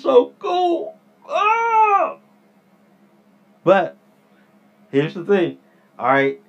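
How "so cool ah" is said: high, thrilled gush; excited squeal. Real, not acted. Vlogger squealing over unreleased watch products, high and thrilled.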